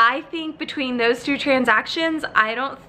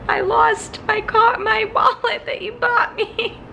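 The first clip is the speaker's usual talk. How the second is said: much higher, whiny self-pity; squeaky mock wail; 12 semitones higher than usual. Wife wailing to her husband about the lost wallet, squeaky and high. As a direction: whine it like a sad little kid. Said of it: "now I'm like".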